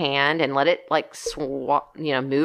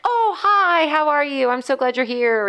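sweet greeting to a snake; 9 semitones higher than usual. Retold or imitated. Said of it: "and I've been like".

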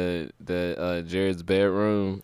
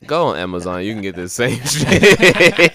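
slow deadpan honesty; 6 dB louder, slower than usual. Retold or imitated. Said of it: "I was like".

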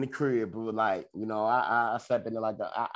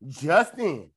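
slower, hammed-up breaking-news commercial voice, laughing through it; mock ad read; 5 semitones higher, 4 dB louder than usual. Joking about a lawsuit commercial, hammed-up announcer with laughs. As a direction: announce the settlement like a cheesy TV ad. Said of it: "these only commercials and shit come on".

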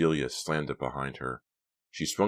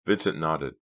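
usual speaking voice

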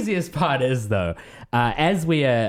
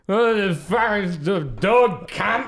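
put-on slow, high drunken slur; town drunk; 8 semitones higher, much slower than usual. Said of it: "slurring his words".

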